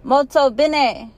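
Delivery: slow, high, cheerful Italian praise. Acted. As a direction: sing it out like a warm Italian teacher. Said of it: "she tells me in Italian".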